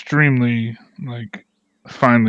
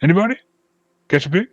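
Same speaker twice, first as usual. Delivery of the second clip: high, hearty festival host; jolly dwarf; 7 semitones higher, 4 dB louder, faster than usual. Acted. Dwarf inviting the group to festival games, high and jolly. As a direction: sell the party with a big grin.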